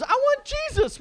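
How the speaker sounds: put-on high, loud toddler tantrum; hammed-up child's demand; whining daughter. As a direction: wail it like a child denied a treat. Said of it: "And she goes".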